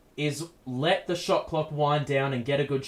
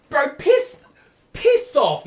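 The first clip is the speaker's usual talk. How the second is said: voice crack; 8 semitones higher, 6 dB louder than usual. Real, not acted. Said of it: "Bro, voice crack".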